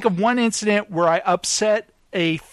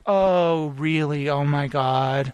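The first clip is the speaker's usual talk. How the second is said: unimpressed co-worker; 5 semitones lower, slower than usual. Retold or imitated. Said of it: "I can imagine her being like".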